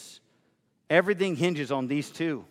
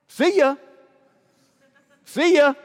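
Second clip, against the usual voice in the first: loud, higher dismissive goodbye; mocking farewell; 10 semitones higher, 10 dB louder than usual. Imagined critic walking out on the church, loud and mocking. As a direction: shout the brush-off.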